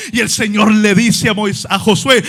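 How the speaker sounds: ordinary talk, nothing marked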